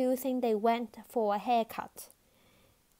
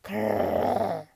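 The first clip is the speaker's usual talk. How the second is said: much lower, loud dog growl; 12 semitones lower, 5 dB louder than usual. A narrator's reading. Fang the dog won't leave till he's done, a deep growl. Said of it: "growled Fang".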